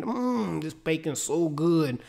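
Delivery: slow, soft, savoring mm over bacon; hammed-up moan of enjoyment, drawn out; taunting a starving prisoner with a slow, soft bacon moan; mock moaning. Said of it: "just moaning".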